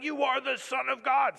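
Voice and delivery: high, loud acted cry; shouting. Preacher voicing demons coming out of people, shouting.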